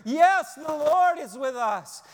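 loud, high, slow cheer of joy; mock cheering. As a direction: shout it like a crowd celebrating. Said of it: "they probably began cheering".

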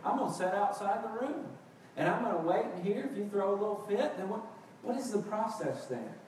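ordinary talk

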